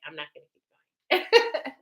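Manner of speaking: real giggles breaking through the words; laughing. Real, not acted. Reader laughs at her own meme joke, giggling through it. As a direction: laugh it off and try to move on. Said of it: "which is why I'm kinda laughing".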